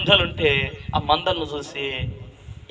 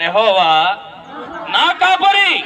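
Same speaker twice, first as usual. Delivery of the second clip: loud shout; 5 semitones higher, 3 dB louder, slower than usual. Shown as a demonstration. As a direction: yell నా కాపరి high and loud. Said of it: "నేను బిగ్గరగా చెప్పమన్నాను, అరిసి చెప్పమన్నాను".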